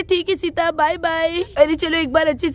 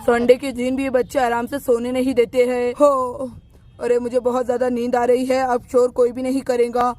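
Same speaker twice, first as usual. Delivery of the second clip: lower, grumbling voice of a man woken on Sunday; lower, drowsy grumble from bed; sleepy man; 5 semitones lower than usual. Acted. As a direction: complain drowsily about the noisy kids.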